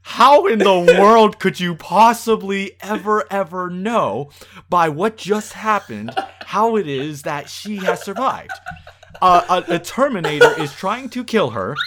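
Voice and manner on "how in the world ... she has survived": louder, slower, incredulous rant with a laugh; bursting with mock outrage at the plot; exasperated disbelief. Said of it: "I'm like".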